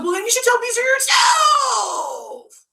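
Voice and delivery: high, drawn-out mock scream; over-the-top retold yell; angry retort. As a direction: imitate a screeched comeback, slowly stretched. Imitating Jill's angry retort.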